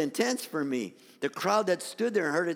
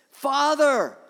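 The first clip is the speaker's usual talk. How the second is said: loud, raised cry to the Father; anguished plea; 7 semitones higher, 7 dB louder, slower than usual. Acted. Jesus begs the Father to save him, anguished plea. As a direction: cry out under a heavy burden. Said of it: "starting to feel the heaviness".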